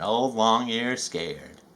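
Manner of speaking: usual speaking voice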